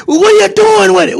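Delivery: high, pushy mock questioning; a stranger demanding what he's doing here, high and pushy; mock nosy patron. Stranger confronting the delivery man, high nosy questioning. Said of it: "he said".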